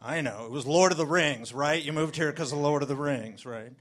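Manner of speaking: a smug local who thinks he's guessed it; lightly voiced smug Kiwi; imitating locals. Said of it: "And then they say".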